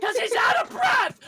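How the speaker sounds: exasperated shout. Real, not acted. Ranting about wrestler.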